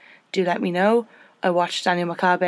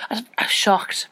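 whispering; 3 semitones higher than usual. Real, not acted. Shocked by risque photo book.

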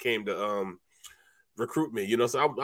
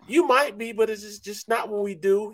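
high-pitched coach, matter-of-fact; 8 semitones higher than usual. Retold or imitated. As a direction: deliver bad news high and light. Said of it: "coach answers".

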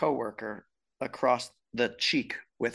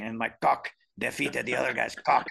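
put-on light Dominican accent on quote; quoting in a Dominican accent; 3 dB louder than usual. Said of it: "with a thick Dominican accent".

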